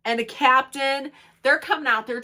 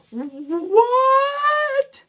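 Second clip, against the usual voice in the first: high, loud, drawn-out disbelief; mock shock; 5 dB louder than usual. The whole room reacts to the accusation, exaggerated shock. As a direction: stretch it out like a stunned onlooker. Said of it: "Everybody was like".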